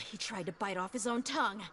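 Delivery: normal talking voice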